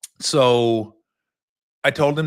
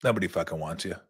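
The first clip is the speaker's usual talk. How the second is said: softer, lower, blunt dismissal; cold put-down; 6 semitones lower, 7 dB quieter, faster than usual. Retold or imitated. Coach telling the benched player no one wants him, softer and lower. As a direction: drop the voice and brush him off. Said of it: "Looks at Pete and says".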